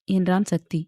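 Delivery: ordinary delivery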